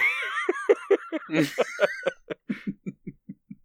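a long shrieking laugh; long high cackle. Real, not acted. Apologizing for the silly convention idea, long high laugh. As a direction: dissolve into laughter.

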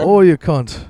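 high, loud put-on accent; mock British-Aussie accent. Shown as a demonstration. Proving the accent is mastered, loud mock British-Aussie accent. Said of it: "You've mastered it".

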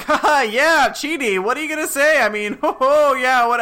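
high, loud fake-cheery stalling; awkward forced cheer; nervous stalling. Eleanor stalling nervously, fake laughing.

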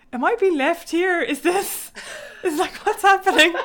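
mock alarm, breaking into a laugh; retold bewilderment. Mum retelling being left in the labour bathroom, bewildered.

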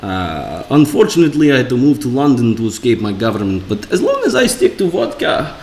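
hammed-up Russian accent, lilting up and down. Russian exile introducing himself to the hosts, mock Russian accent. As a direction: play Vladimir with a Russian accent. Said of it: "I have to s-, do Russian".

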